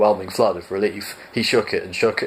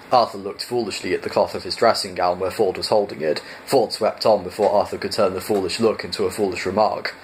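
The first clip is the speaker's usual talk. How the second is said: ordinary delivery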